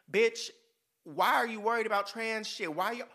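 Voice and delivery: rising rant. Acted. Scene: ranting at straight men, loud and exasperated. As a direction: scold them, fed up.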